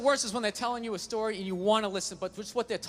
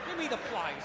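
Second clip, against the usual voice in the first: hammed-up grumbling dad, higher; a grouchy father snapping at his wife; mock dad insults; 6 semitones higher than usual. Dad insulting mom, mock grouchy tone.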